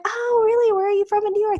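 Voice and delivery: high-pitched mock interest; retold skeptical question. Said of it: "And I'm like".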